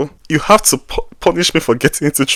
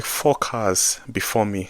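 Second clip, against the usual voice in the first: ordinary delivery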